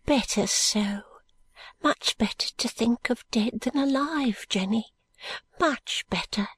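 high, hushed, slow and bitter comfort; bitter consoling friend. Acted. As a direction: whisper hard comfort to a grieving friend. Woman consoles her friend Jenny.

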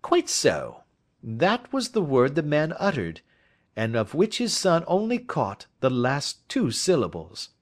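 a confident detective laying out his case; lightly voiced detective. Detective asking his friend for advice, lightly voiced. Holmes naming the murderer's clue.

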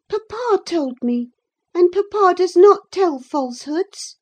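put-on high, pert girl's retort. A narrator's reading. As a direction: answer back smartly in a high voice. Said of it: "she answered pertly".

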